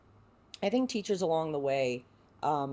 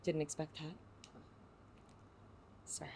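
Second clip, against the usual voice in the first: very soft, overcome apology; hushed, halting, caught off guard by feeling; emotional; 17 dB quieter than usual. Real, not acted. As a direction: barely get it out, really moved. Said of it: "I'm getting emotional".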